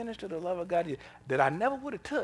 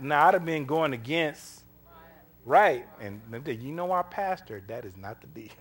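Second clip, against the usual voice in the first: ordinary delivery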